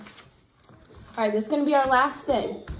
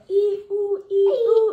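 high stretched vowels; 9 semitones higher, 7 dB louder, much slower than usual. Shown as a demonstration. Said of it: "demo of oral-motor sounds".

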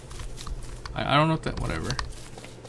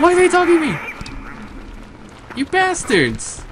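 outraged squeal; 3 dB louder than usual. Real, not acted. Gamer yelling at attacking monsters, high outraged cry. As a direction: yell at the monsters in shrill outrage. Mobs keep attacking him.

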